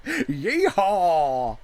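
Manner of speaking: put-on loud, drawn-out country-accent yeehaw. Songwriter joking about a terrible country accent, cowboy whoop. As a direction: whoop it like a hammy American cowboy. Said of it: "your terrible American country accent".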